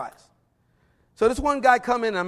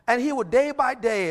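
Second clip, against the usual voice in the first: normal talking voice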